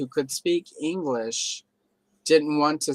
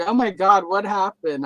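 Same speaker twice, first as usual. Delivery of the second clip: higher, louder mock astonishment; a touch of exaggerated alarm, pitched up; imitated shock; 5 semitones higher, 4 dB louder than usual. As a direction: retell a caller's startled reaction. Retells caller's shocked reaction.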